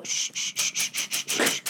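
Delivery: repeated sharp shushes; string of hissed shh sounds; urgent shushing. Retold or imitated. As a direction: shush yourself over and over.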